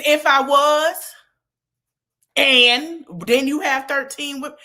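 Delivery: loud, sassy scolding retort; loud scolding comeback. Pretend. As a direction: throw it back in his face loudly. Co-host tells her what to say.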